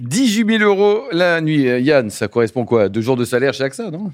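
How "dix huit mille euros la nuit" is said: astonished echo. Real, not acted. Echoing the price in astonishment.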